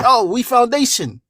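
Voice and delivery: sneering impression of FBA ladies; mocking quote. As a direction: lampoon their boast. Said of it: "They say".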